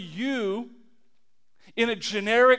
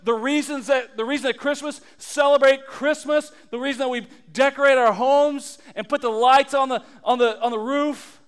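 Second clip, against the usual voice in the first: ordinary delivery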